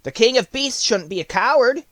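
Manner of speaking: a lively straw man protesting; bright-voiced scarecrow objecting.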